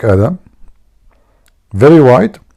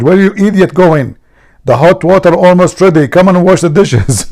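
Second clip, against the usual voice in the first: high, loud scolding wife; wife screaming; 8 semitones higher, 5 dB louder than usual. Retold or imitated. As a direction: shriek at the husband like an angry wife. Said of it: "His wife, she screamed".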